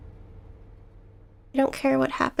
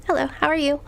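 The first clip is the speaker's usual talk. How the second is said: ordinary talk, nothing marked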